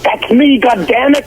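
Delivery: high-pitched mock-indignant outburst; retold exclamation. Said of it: "I'm like".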